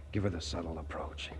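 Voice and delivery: hushed whisper to himself. Acted. Shy suitor whispering his plan to himself. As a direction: breathe it out under the breath.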